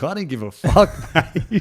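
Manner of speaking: cocky mock outburst with a laugh; retold defiant retort. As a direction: brush off the warning loudly. Retold defiant retort in a heated call.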